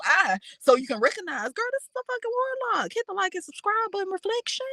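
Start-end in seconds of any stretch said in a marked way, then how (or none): 1.5-4.7 much higher and fast, breathless squeal; high fast exasperation. Acted. Commentator shrieks at the storyteller about the warlock. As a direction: squeal it out in disbelief.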